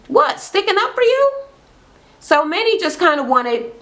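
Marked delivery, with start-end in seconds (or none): 0.1-1.3 high-pitched mock incredulity; retold protest, pitched way up; indignant question. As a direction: act out a wounded friend's retort. Acted indignant reply in quarrel.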